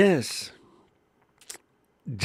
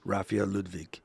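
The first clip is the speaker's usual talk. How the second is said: "Ludwig" said as "Ludvik", German way; German pronunciation of Raphael Ludwig; German accent; 4 semitones lower, 5 dB quieter than usual. Shown as a demonstration. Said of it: "in proper German".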